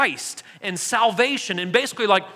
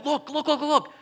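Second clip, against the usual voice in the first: quick eager repeated "look", pitched up; imitating excited eunuch; 5 semitones higher than usual. Eunuch urging Philip as they ride, excited. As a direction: play the eunuch bursting with excitement. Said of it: "like, super excited".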